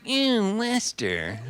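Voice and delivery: put-on high, drawn-out drunken lilt; sloppy high-pitched Capote drawl; Truman Capote impression. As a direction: croon the name up high, then let it sag. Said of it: "'cause he talked like that".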